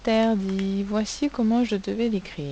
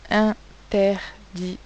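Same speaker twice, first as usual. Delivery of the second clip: "interdit" split as in, ter, dit; separated syllables in "interdit"; 4 dB louder, much slower than usual. Shown as a demonstration. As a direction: spell out "interdit" in three beats for listening. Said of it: "Où est-ce que j'entends le son".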